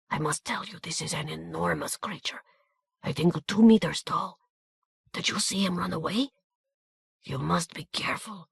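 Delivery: hushed Hungarian warning to Peter; whispered warning. A narrator's reading. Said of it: "a long whispered commentary".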